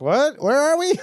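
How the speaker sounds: high-pitched, incredulous questioning; mock bafflement. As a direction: play a totally lost viewer, voice jumping up. Acts a baffled viewer of Frozen two.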